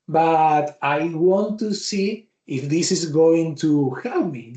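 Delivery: ordinary talk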